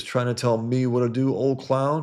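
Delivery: hammed-up cowboy challenge; taunting challenge. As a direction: sneer it at him like a saloon brawler. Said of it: "He goes".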